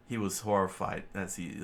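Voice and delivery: plain reading voice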